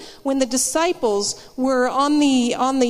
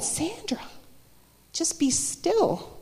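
soft, slowed voice of the Lord, retold; quietly calming, as if soothing someone panicking; gentle hushing; 3 semitones higher, 8 dB quieter, slower than usual. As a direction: hush them gently, drawing out the words. The Lord calms her panic.

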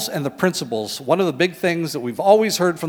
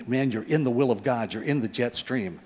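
ordinary talk

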